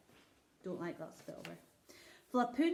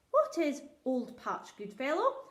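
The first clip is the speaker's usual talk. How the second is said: a king asking kindly but distracted; lightly voiced king; 5 semitones higher, 6 dB louder than usual. King questions the shepherd.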